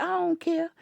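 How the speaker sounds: put-on sass it like a spoiled little kid; bratty child. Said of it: "that child said".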